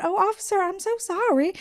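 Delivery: high-pitched, sugary Southern apology; sweet Southern accent. Retold or imitated. Woman pulled over apologizing to an officer, sweet Southern accent. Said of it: "did use my Southern accent".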